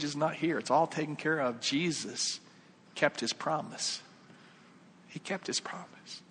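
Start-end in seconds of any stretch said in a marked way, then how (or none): 1.6-4.0 slow, softer, voiced; measured, quiet conviction; solemn assurance. Real, not acted. Preacher assures about Jesus at judgment, solemn. 5.1-6.2 near-whisper, very soft; hushed reverence. Real, not acted. Preacher repeats Jesus kept his word, hushed.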